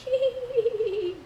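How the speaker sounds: put-on high, far-off little child's giggle; eerie child giggle. Coworker recalling a creepy giggle in the office, high eerie child laugh.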